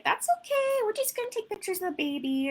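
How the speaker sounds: very high, breezy reassurance; cheerful toddler voice. Retold or imitated. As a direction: let the refusal slide off brightly. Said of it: "I sound ridiculous when I'm doing sessions".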